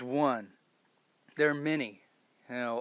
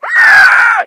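a shrill, much higher, much louder screech; goblin scream; 23 dB louder than usual. Retold or imitated. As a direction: scream like a goblin being hurt.

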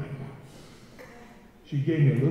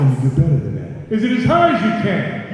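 hyping the crowd; 6 dB louder than usual. Real, not acted. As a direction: pump up the room. Crowd call-and-response.

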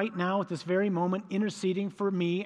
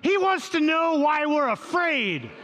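much higher and louder, slow, shouting across; yelled answer; 10 semitones higher, 4 dB louder, slower than usual. Retold or imitated. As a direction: shout it back over the wind. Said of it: "John's like".

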